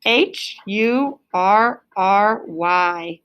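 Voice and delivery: letters spelled out slowly one by one; spelling aloud slowly for the class. Shown as a demonstration. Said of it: "We have hurry".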